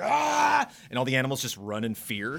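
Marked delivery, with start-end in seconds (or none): 0.0-0.7 high yelping ah; mock scream. Toad covered in ice cream, high startled yell. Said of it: "he's like".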